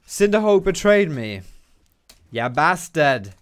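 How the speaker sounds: loud, drawn-out mock indignation; mock outrage. Player blaming Cinderhulk for betraying him, mock outraged. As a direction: cry betrayal in hammed-up outrage.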